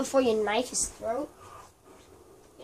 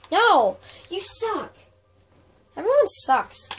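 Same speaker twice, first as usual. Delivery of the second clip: frustrated yelling; 7 semitones higher, 8 dB louder, slower than usual. Real, not acted. Raging at game.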